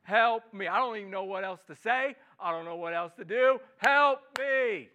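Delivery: loud desperate prayer; crying out to God. Shown as a demonstration. Pastor voices a desperate prayer aloud, loud and pleading. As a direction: plead loudly, words tumbling out. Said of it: "Just a crying out to God".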